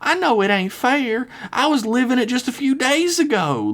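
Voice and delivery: wide-eyed, pleading and high; high southern girl voice. Acted. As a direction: beg her with a high sweet voice. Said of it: "Lizzie said with her eyes wide".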